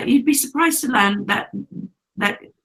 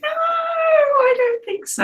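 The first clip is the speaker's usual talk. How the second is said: No, I don't think so pulled out slow and much higher; teasing back a friend about royalty lessons, hammed-up slow high wail; mock high wail; much slower than usual. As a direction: ham it up in a silly high wail. Teased about royal training.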